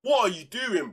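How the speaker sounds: exasperated yell. Real, not acted. Exasperated at defender.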